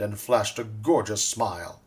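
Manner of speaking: ordinary delivery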